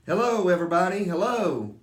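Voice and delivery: put-on a boy on top of the peach calling down to the crowd below, loud and cheerful. A narrator's reading. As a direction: call it out with a wave down to the crowd. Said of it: "laughing and waving and calling out".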